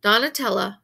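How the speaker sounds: plain reading voice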